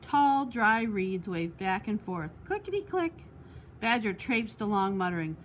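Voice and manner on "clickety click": high light clickety-click. A narrator's reading. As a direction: tick it out lightly. Reeds sound word.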